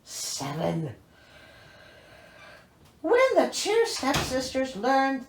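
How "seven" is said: ordinary delivery, nothing marked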